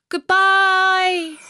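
very high, loud farewell; a young girl calling goodbye; little girl voice. Acted. Gerda voiced saying farewell.

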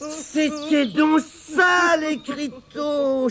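high, slow, drawn-out cry of realisation; surprised exclamation. Acted. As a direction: exclaim as it dawns on you. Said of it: "s'écria Tirondin". (s'écrier, surpris, en traînant les mots)

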